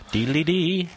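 ordinary talk, nothing marked

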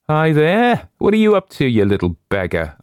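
high-pitched call, dropping back at the end. A narrator's reading. Man calling out to a mouse, high playful challenge. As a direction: call out sharply in mock challenge. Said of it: "I called out".

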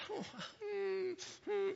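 high, soft mock whimper; whimper over toe. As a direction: imitate a woman nursing a stubbed toe. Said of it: "you sit down and you nurse your toe".

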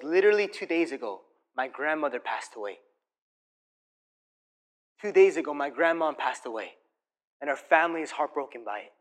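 soft, low, understated sorrow; imitated composed grief. Waitress telling of grandmother's death, quietly composed retelling. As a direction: retell it quietly, as if gathering yourself. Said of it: "Finally, she gathers herself".

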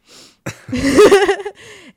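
loud, high burst of laughter; real laugh. Real, not acted. Speaker laughing after admitting to being a lover boy, amused. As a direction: crack up loudly at your own confession.

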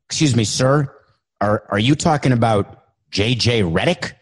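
usual speaking voice

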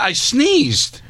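high, indignant protest; exasperated. Real, not acted. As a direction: pitch way up in protest. Insists again, exasperated, much higher.

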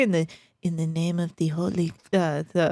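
put-on low, hammy Italian accent; Italian accent impression. Co-hosts quote the film star's trailer line, hammy Italian accent. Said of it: "She is, what did she say?".

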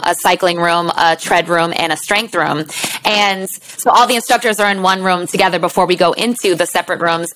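usual speaking voice

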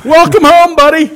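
high bright sarcastic welcome; mock cheery greeting. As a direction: greet him like a cheerful host. Mock greeting at overdose scene.

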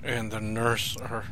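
soft, hushed aside to the audience. Real, not acted. Whispering to the audience in the lift, hushed. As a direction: keep it down so the doctor can't hear.

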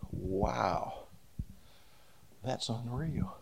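low, soft retold disbelief; hushed amazement. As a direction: let it drop to a stunned near-murmur. Stunned reaction in retold warning.

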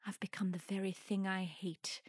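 breathy whisper of horrified realization; hushed melodramatic confession; stage whisper. Acted. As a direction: whisper it, appalled at yourself. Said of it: "Oh no".

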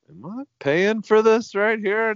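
stunned that the bill might be his; disbelief at the bill. Retold or imitated. Shocked by the vet bill.

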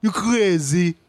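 Haitian dad scolding, pitched high; a Haitian father chewing out his son; Haitian father's accent. Retold or imitated. Haitian father scolding his son in a high accent. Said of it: "And my father's".

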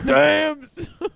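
high, loud damn son; loud high exclamation. Acted. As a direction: shout the catchphrase up high. Repeating catchphrase loud high.

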